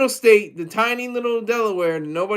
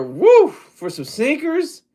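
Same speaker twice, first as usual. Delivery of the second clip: high, slow woo of disbelief; a drawn-out woo at the price; amazed woo; 7 semitones higher, slower than usual. Real, not acted. Reacting to sneaker prices.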